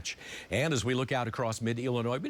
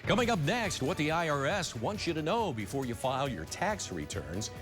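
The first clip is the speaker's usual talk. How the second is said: news tease read; 4 semitones higher than usual. Acted. Anchor teases upcoming stories.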